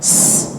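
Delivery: "S" as a sharp hiss. Shown as a demonstration. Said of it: "S bunyinya itu tajam". ("S" yang tajam)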